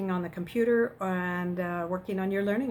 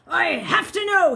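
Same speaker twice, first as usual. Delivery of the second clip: loud, higher desperate shout; 9 semitones higher, 9 dB louder than usual. Acted. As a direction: yell it out in despair. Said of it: "he shouted".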